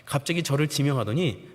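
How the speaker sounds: ordinary delivery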